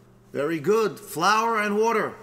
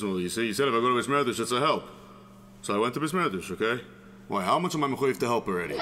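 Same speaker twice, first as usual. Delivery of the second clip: low, quick self-justifying excuses; defensive husband; 6 semitones lower, much faster than usual. Acted. Husband caught back home by his wife, defensive. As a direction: rattle off a husband's defence, pitched down.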